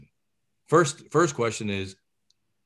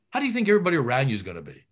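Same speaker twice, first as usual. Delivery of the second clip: ordinary talk, nothing marked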